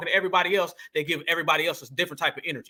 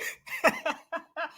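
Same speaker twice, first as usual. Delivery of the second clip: laughing at the clip; 9 semitones higher, 6 dB quieter than usual. Real, not acted. Laughing at freeze frame.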